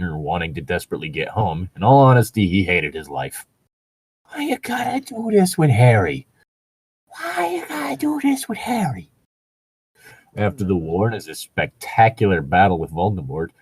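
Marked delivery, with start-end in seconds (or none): 4.3-6.3 high, put-on voice pleading; mock indignant plea. 7.1-9.1 high, breathless whispered plea; mock whisper. Whispered repeat of line 20.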